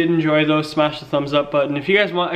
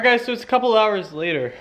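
normal talking voice

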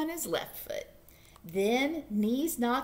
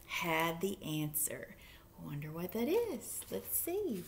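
whispering; 4 semitones lower, 8 dB quieter than usual. A narrator's reading. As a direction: drop to a secret whisper. Storyteller's dramatic hush.

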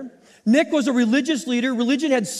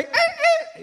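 very high squealing screech of landing wheels; plane screech imitation. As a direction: mimic tires screeching, laughing through it. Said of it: "I think that's how it sounded".

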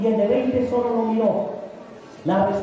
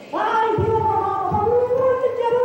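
put-on much higher, sing-song baby talk; cooing at a baby; 11 semitones higher than usual. Parent cooing tenderly to a smiling toddler. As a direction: coo it like a parent holding a toddler.

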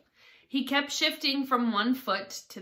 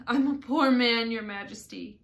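insistent plea for mercy; pleading Hatter; 3 semitones higher, 7 dB louder than usual. A narrator's reading. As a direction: implore the king with raised voice. Hatter pleads on one knee.

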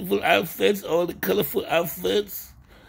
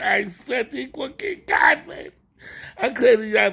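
normal talking voice

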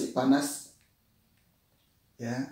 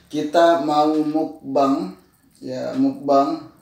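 slow drawn-out; 3 dB louder, much slower than usual. Real, not acted. Announcing mukbang to viewers.

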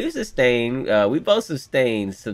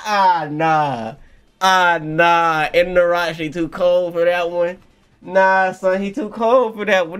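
raised, loud voice of amazement; loud awe; 5 semitones higher, 6 dB louder, slower than usual. Real, not acted. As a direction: holler your admiration in disbelief. Loudly marvelling at the character.

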